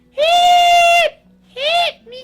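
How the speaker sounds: very high, loud falsetto shriek; screaming. Acted. Little Red tied in the bag, high screaming. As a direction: scream for help as a trapped little girl. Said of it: "Little Red's in there screaming".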